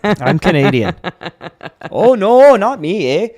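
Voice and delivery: loud, high mock Canadian accent with a laugh; over-the-top Canadian accent with a laugh. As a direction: ham up a Canadian abroad denying he's American. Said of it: "the accent I cannot do".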